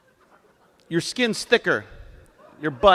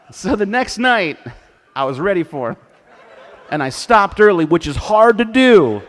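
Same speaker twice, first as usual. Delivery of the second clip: excited storytelling; 6 semitones higher, 9 dB louder than usual. Real, not acted. Excited retelling of dog rivalry.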